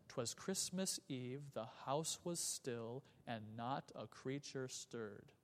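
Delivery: slow, soft, measured iambic lines; slow soft suspenseful recitation; slow solemn reading. Shown as a demonstration. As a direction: read it hushed, each beat weighed. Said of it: "something sinister is gonna happen".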